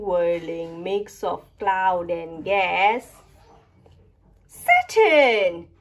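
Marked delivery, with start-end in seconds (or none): none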